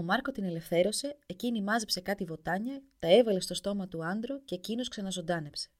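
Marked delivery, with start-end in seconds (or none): none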